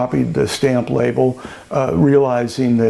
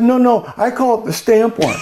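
high, light imitation of an insistent woman; insistent seatmate; 8 semitones higher than usual. Seatmate insisting to the winemaker, high mock insistence. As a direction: correct him, lightly imitated.

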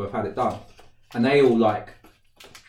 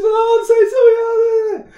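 very high, loud, frantic sorry; panicked apology; 10 dB louder than usual. Retold or imitated. Friend panicking after he trips, high and loud. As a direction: squeal it out in a panic. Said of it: "She's going to the panic".

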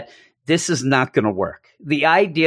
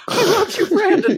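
mock crying. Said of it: "I'm crying".

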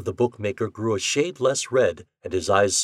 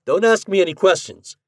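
hurried command; 10 semitones higher, 7 dB louder than usual. A narrator's reading. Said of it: "he commanded hurriedly".

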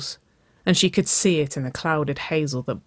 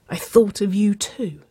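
higher whimper, falling away at the end; 6 semitones higher than usual. A narrator's reading. Nicole overwhelmed by her lover's confession, whimpering. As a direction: whimper it, overcome. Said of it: "Nicole whimpered".

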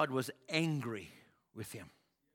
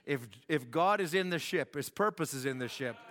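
ordinary talk

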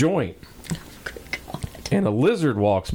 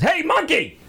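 crocodile calling out. Acted. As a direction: call up to the monkey. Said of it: "he says".

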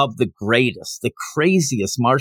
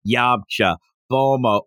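normal talking voice

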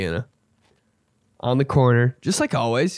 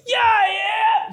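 very high-pitched cry; catcall yell. Acted. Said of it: "I'm not gonna be like".

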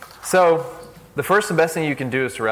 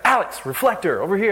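higher, quick barked order; director's orders; 8 semitones higher, 3 dB louder than usual. Retold or imitated. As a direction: call out an order from the ladder. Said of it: "he's, like, giving out orders".

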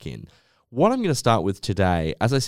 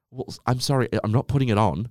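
ordinary talk, nothing marked

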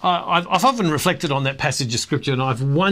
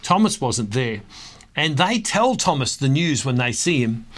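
ordinary talk

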